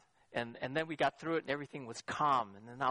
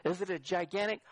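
ordinary talk, nothing marked

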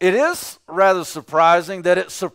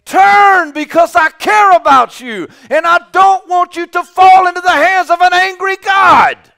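full-voiced pleading shout, much higher and louder; shouting and screaming; 13 semitones higher, 11 dB louder than usual. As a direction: scream it out as a warning to turn. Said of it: "is to only shout and scream".

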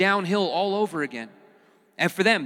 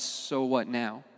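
quiet questioning; 7 semitones lower, 9 dB quieter, much slower than usual. Acted. Pastor muses on the question.

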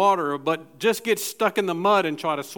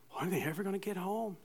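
much softer, fast mutter under the breath; worried muttering; 13 dB quieter, faster than usual. Retold or imitated. Parent waiting up at night, fast soft worried mutter. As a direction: grumble it quickly to yourself.

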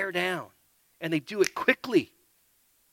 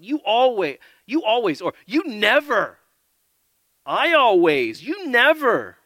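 loud, higher, jabbing accusations; angry accusing; 7 semitones higher, 7 dB louder than usual. Acted. A spouse in a quarrel hurling blame, angry and loud. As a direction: throw the blame hard. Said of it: "How about".

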